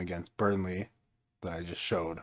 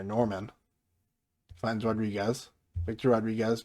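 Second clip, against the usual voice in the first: ordinary talk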